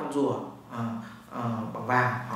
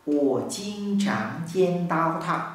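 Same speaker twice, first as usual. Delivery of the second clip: slow demo; much slower than usual. Shown as a demonstration.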